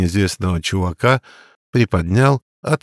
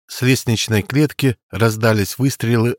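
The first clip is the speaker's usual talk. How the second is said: usual speaking voice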